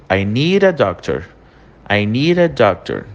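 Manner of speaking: "need a" said as one linked "nee-ra"; R de ligação. Shown as a demonstration. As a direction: model "I need a doctor" joined up, with an R for the D of "need". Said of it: "entra um R entre o need e o a". ("need a" emendado, com R no lugar do D)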